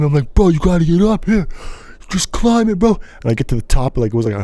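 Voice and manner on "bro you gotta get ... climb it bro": urging friend. Retold or imitated.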